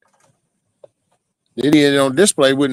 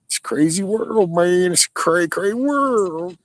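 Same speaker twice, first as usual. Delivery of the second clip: pitched way up, silly and sing-song; goofy high voice; 9 semitones higher, slower than usual. Pretend. Joking to the stream in a high silly voice. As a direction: squeak it out like a cartoon. Goofy aside on a crazy world.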